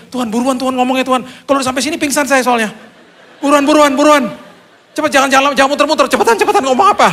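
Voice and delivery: high, loud, panicky pleading; frantic urging. Retold or imitated. Patient begging God to speak before he faints, frantic urging.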